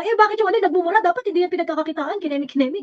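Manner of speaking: high, whiny mock complaint; mocking critic. Mocking the critics who complain about his swearing, in a whiny tone. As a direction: parrot the critics' scolding with a sneer. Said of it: "sasabi na yung parang".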